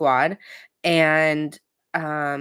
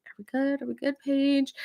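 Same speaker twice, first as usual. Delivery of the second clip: mock pleading Kyle; 9 semitones higher than usual. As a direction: coax her like a needy boyfriend. Kyle pleading with Paige at dinner.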